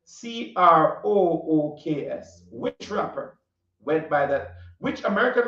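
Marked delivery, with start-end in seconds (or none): 0.1-2.4 spelling it out. Shown as a demonstration. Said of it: "my Caribbean accent is a bit thick".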